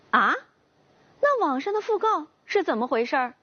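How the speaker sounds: taken aback and bewildered; puzzled. A narrator's reading. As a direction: ask in confused surprise. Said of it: "朋友很是疑惑".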